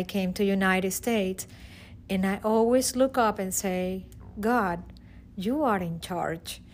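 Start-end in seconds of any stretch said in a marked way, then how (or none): none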